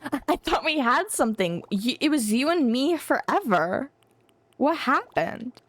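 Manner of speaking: playfully wounded, swooping pitch; mock heartbreak. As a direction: plead like a jilted lover, hammed up. Mock-hurt scene continues.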